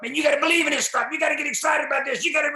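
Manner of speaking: fast, loud, high pep-rally shout; hyped-up speaker quote. Retold or imitated. A speaker at a sales meeting, shouting hyped-up encouragement. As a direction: mimic the over-eager motivational speakers. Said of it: "just talk about".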